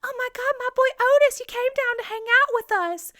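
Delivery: very high, loud gushing mom voice; squealing high mock mother; shrill mother. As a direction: gush like an overjoyed mother. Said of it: "Mother's like".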